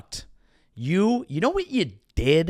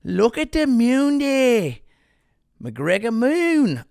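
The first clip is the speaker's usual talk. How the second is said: slow, much higher Irish drawl; McGregor Irish accent; 8 semitones higher, slower than usual. Retold or imitated. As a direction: stretch it out like McGregor in awe. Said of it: "McGregor looks at moon".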